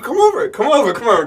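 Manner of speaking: high, sing-song come over; mock inviting call. Casting team beckoning him, high sing-song call.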